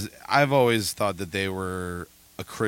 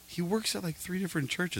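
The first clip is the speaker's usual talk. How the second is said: incredulous; 10 semitones higher, 7 dB quieter than usual. Real, not acted. Disbelief at friend's question.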